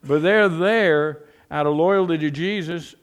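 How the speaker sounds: normal talking voice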